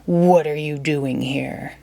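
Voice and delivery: hint of a monstrous beast demanding answers; beast's growl. A narrator's reading. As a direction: loom over the intruder. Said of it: "he roared".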